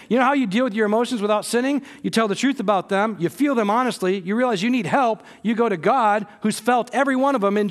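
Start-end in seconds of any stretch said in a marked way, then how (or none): none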